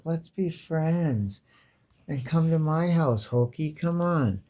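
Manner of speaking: put-on high, slow, luring little girl; slow coaxing girl voice. Girl coaxing Hulk home, high and slow. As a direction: draw him in sweetly, slowly. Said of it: "You're like".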